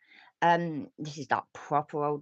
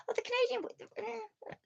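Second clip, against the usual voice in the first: sudden remembering; 3 dB quieter than usual. Real, not acted.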